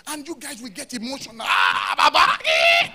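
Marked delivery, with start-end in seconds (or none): 1.4-2.9 high, loud ecstatic shouting; hype preacher shouting. Shown as a demonstration. Pastor demonstrating hype preaching, high loud shouts. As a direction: fire the crowd up, shouting high. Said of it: "I can start running like".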